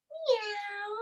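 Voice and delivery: high cat meow; kid-friendly cat sound; playful meow. Pretend. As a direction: meow like a kitty for the kids. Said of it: "One more cat".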